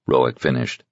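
ordinary delivery

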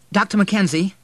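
high, loud anxious query; urgent caller. A narrator's reading. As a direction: reach urgently for the doctor. Frantic son phones the doctor.

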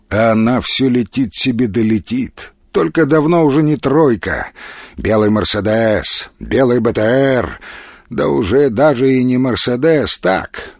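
plain reading voice

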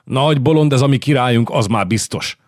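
angry lord bellowing about the king; full-voiced outburst. A narrator's reading. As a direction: let your voice out in a roar. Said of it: "amikor kiereszti a hangját".

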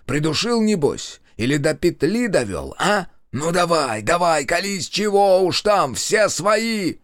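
high, insistent needling; goading interrogation. A narrator's reading. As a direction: goad them into confessing. Gloating interrogation, goading a confession.

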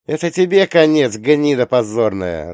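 loud, gloating exclamation; gleeful shout. Acted. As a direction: shout it with glee. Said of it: "радостно восклицает Кириан".

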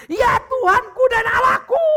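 langzame, hoge, luide uitroep van geloof; slow, high, loud cry of faith. Acted. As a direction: exclaim slowly, high and loud, in awe. Thomas's awed confession.

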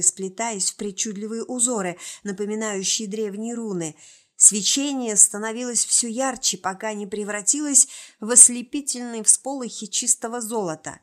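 plain reading voice

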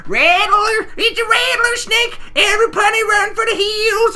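very high, loud shriek of alarm; mock panicked scream. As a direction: scream a hammed-up warning to everyone. Acting out panicked warning in retold episode.

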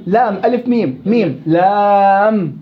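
teacher stretching لام to expose its ending; drawn-out spelling demo. Shown as a demonstration. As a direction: spell out each letter name, stretching "لام" slowly. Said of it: "نهاية هجاء اللام".

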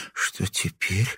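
growing dread. Acted. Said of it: "холодея спросила Дасова".

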